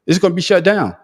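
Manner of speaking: ordinary delivery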